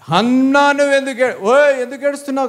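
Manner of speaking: harsh mock scolding. Husband demanding why Hannah is crying, harsh mock scolding. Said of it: "కాదు, అక్కడ బాగా అన్నాడు".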